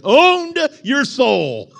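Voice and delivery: slow, high, loud outrage; indignant shout. Retold or imitated. Preacher reads a church's rule as a slow, loud shout. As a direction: land it like a shocked accusation.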